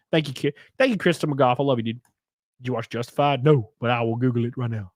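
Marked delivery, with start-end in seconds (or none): none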